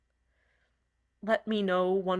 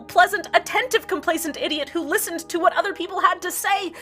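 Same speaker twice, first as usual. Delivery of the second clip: higher and louder, heated sarcastic tirade; bitter rant; 7 semitones higher, 6 dB louder than usual. Acted. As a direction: spit out every insult in a rising fury. Host rants bitterly about fight.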